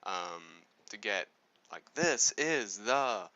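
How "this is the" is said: slow, loud, drawn-out words; dramatic emphasis. Shown as a demonstration. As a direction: lean hard on each word. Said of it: "that kind of dramatic".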